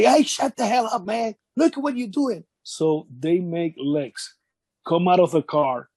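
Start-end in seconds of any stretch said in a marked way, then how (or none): 0.0-2.4 higher, mock angry snap; lightly voiced furious friend; exasperated Lex. Lex snapping at Owen during the traffic stop, mock angry.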